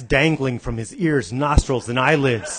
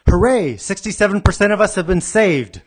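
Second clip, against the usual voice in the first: higher, louder hammed-up hooray; mock cheer; 7 semitones higher, 5 dB louder than usual. [name] celebrating the survivors, mock excited cheer. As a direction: cheer with deadpan glee.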